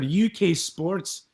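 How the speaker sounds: slow, lightly Scottish "U K Sports"; a hint of the Scottish reporter, drawn out; mild Scottish. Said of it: "his name is Ian McGregor".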